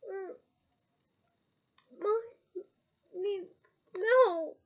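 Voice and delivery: muffled through a gag; gagged. Acted. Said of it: "shouted Ron through his gag".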